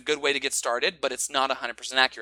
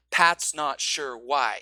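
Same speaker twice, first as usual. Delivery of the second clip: slow, each word its own beat; evenly stressed words; every word stressed; 3 dB louder, much slower than usual. Shown as a demonstration. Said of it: "this example".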